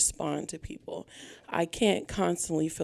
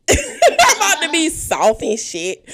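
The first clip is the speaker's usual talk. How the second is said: laughing; 8 semitones higher, 11 dB louder than usual. Real, not acted. Nervous laugh about being vulnerable.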